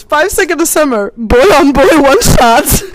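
high, shouted title announcement; loud reveal. Retold or imitated. Telling friends what fan fiction popped up, shouted high in disbelief. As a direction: blurt the title out, loud and drawn out. Reveals the absurd search result.

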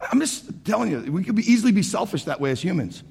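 normal talking voice

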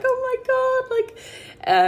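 imitating her own rush of delight; amazed gasp. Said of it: "and I'm like".

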